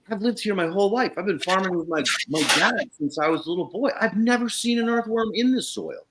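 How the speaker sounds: high, lightly voiced old farmer in awe. Old neighbor telling Kyle he never saw earthworms, high and awed. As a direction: play the old neighbor, amazed. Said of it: "he goes".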